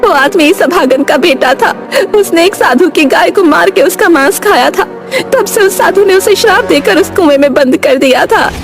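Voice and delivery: high-pitched explaining aunt; village aunt Vimla. Acted. (गांव की काकी की ऊँची आवाज़)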